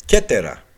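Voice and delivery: κέτερα as "KEH-te-ra", opening on k; hard k reading. Shown as a demonstration. Lecturer linking it to a Greek island pun, hard-k pronunciation. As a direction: stress the first syllable of κέτερα after a hard k. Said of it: "διαβάζεται και κάπα κέι".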